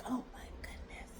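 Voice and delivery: a near-silent whispered aside to oneself; whispered exasperation. Retold or imitated. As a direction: breathe it out in a whisper. Exasperated aside at his request.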